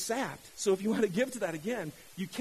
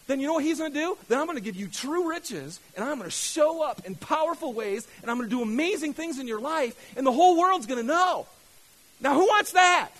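high, loud, rising to a shout; rally the room at full force; fired-up preaching; 6 semitones higher, 9 dB louder than usual. Real, not acted. Pastor promising true riches to the congregation, fired-up preaching. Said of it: "I'm so sorry for getting fired up".

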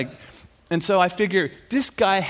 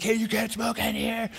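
higher, hushed protest; indignant onlooker; 4 semitones higher, 5 dB quieter than usual. Retold or imitated. Restaurant crowd objects to the smoker.

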